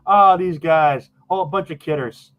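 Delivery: drawn-out, lightly voiced amusement; amused leprechaun. Leprechaun reacting to the guests' joke, amused teasing. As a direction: tease the guests, starting high and easing down. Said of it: "They start laughing".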